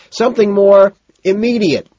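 high, loud reply; shouting back. A narrator's reading. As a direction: yell back over the din. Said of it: "Price shouts back".

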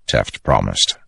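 ordinary delivery

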